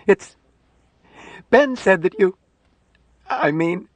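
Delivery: high, halting, faltering protest; acted distress, words failing her; breaking toward sobs. Nurse tries explaining the body, close to sobbing. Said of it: "She put her head down and sobbed".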